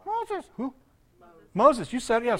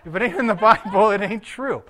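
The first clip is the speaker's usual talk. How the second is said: louder emphatic repeat; 2 semitones higher, 7 dB louder than usual. Shown as a demonstration. Preacher repeats it louder so the congregation echoes it. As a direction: repeat the line louder, hitting "in" in "If it ain't in the Bible" and "true" in "it ain't true". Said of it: "He's not repeating it".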